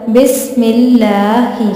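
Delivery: बिस्मिल्लाही recited slowly, thin light लाम; slow Quranic recitation. Shown as a demonstration. Teacher recites an example for students, slow and melodic. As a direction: stretch बिस्मिल्लाही out like a tajweed teacher. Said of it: "यहाँ पे लाम से पहले ज़ेर है".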